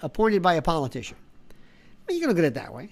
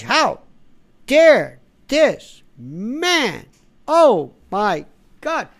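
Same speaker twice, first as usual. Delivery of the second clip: higher, loud, very slow mock outrage; mock indignation; 4 semitones higher, 7 dB louder, much slower than usual. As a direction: sneer out a drawn-out dare. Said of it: "feigned, fake, synthetic indignation".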